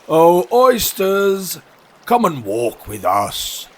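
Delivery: long, loud, high call falling away; beseeching walrus. Acted. Walrus luring oysters for a walk, beseeching. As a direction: coax them with a drawn-out hail.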